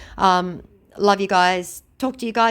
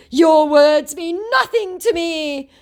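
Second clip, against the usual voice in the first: high, slow, mocking scammer voice; triggered scammer; 14 semitones higher, 6 dB louder, slower than usual. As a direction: sneer it out slowly as the rattled scammer. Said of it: "said my completely triggered scammer".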